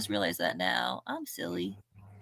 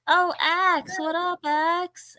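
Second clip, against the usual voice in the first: excited greeting; 9 semitones higher, 8 dB louder, much slower than usual. Real, not acted. Excited greeting to a new viewer.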